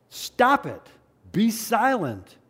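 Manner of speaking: acted crowd telling someone off; sharp rebuke. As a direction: shush a troublemaker loudly. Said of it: "that's what he's being told".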